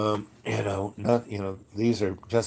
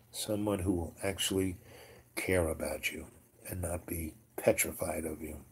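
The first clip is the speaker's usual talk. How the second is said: lower, much softer, earnest reassurance; gentle sincerity; 5 semitones lower, 11 dB quieter than usual. Retold or imitated. Offering the feared master true friendship, gently sincere. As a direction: drop into a quiet, heartfelt offer.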